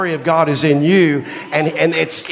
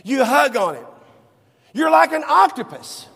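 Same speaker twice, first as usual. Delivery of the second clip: mother scolding; 6 semitones higher than usual. Retold or imitated. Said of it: "She goes".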